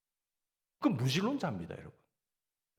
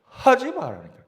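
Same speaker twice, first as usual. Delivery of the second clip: loud, higher Gyeongsang "하지 말라니까" with the accent hit hard up front; Gyeongsang accent, front-loaded; 11 semitones higher, 10 dB louder than usual. Shown as a demonstration. Pastor showing the congregation how Gyeongsang speech sounds angry, loud Gyeongsang accent. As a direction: bark "하지 말라니까" Gyeongsang style, high and loud at the start. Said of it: "이런 거 하고".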